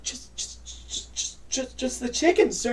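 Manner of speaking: hushed, stuttering waitress; nervous stammer. Acted. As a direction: stammer it out to a feared captain. Nervous waitress answers captain's questioning.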